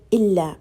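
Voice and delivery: "Illa" with a long double l; correct Arabic. Shown as a demonstration. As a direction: stress the ll in "Illa".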